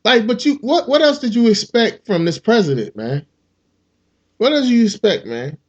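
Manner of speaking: ordinary talk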